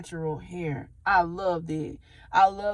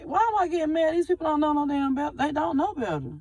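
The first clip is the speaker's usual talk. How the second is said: higher, faster retelling of her own frustrated thoughts; imitating her own baffled inner voice, pitched up; exasperated self-talk; 7 semitones higher than usual. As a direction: replay how she scolded herself, a little worked up. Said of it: "'Cause I'm like".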